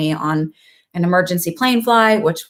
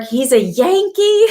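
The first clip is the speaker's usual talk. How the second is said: slow, higher, louder mock-horrified old lady; shocked grandmother; 5 semitones higher, 5 dB louder, slower than usual. Grandma hearing the boyfriend is a Yankee, mock horror. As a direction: play the scandalized Southern grandma.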